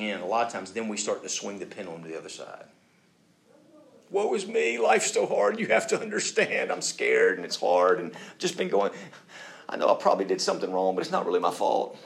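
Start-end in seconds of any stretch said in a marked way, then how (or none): 4.1-6.7 high, hammed-up self-pity; mock victim. Playing the victim card to get out, mock woeful. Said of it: "playing the victim card". 6.7-12.0 overplayed poor-me excuses; mock victim. Playing the victim card to get out, mock pleading. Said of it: "playing the victim card".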